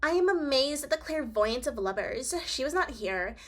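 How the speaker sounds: high, teasing snooty voice; mean girl voice. Acted. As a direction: tease him like a mean girl. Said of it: "mean girl voice".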